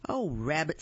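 ordinary delivery

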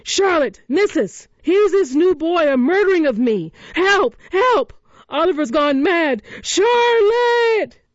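high, loud cowardly screaming for help; panicked shouts. A narrator's reading. Noah shouts for help from the floor, panicked and screaming. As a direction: shriek for Charlotte in terror. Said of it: "Noah's shouts".

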